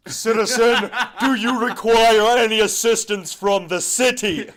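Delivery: high, loud stiff official voice; mock robotic city official. City helper greeting holler folks in a stiff official voice. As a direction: greet like a pompous city bureaucrat.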